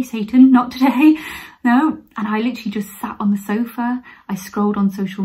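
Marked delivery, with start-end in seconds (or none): 0.0-2.0 louder, higher, jokey refusal with a laugh; mock defiant refusal. Vlogger refusing the dishes in her sink, mock defiant. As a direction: playfully banish the chore out loud.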